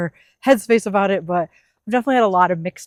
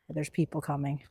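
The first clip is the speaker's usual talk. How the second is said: much softer, low-pitched undertone; hushed aside; 5 semitones lower, 14 dB quieter than usual. Real, not acted. Vlogger noticing people nearby, hushed aside. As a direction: keep it down, very soft and low.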